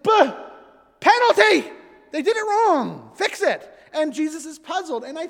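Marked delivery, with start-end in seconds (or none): 0.0-3.7 high, loud, hammed-up cry of foul; mock indignation. Disciples griping at strangers, a mock outraged protest. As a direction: call a penalty like a petty referee.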